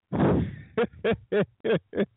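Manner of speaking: high-pitched chuckle; laugh, tickled by it all; high, rolling chuckle. Real, not acted. Tickled by the psychic callers.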